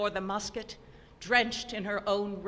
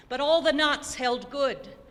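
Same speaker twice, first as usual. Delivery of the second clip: ordinary delivery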